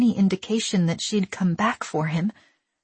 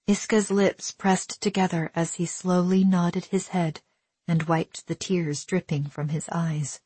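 usual speaking voice

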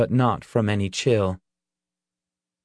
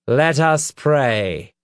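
slow, raised preacher's call; solemn pronouncement; 4 semitones higher, 3 dB louder, much slower than usual. A narrator's reading. Reverend calls the crowd to prayer, pronouncing solemnly. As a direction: pronounce it to a congregation, slow and high. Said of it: "he pronounced".

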